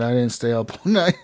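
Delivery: normal talking voice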